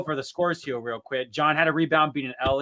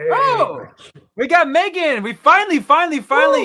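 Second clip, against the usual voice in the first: high, loud, thrilled greeting; excited welcome; 12 semitones higher, 9 dB louder than usual. Real, not acted. Host to Megan, a thrilled loud welcome. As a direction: cheer the long-awaited guest in.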